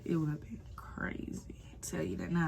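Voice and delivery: whispered aside to viewers. Real, not acted. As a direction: lean in and whisper it. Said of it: "aside to viewers, hushed".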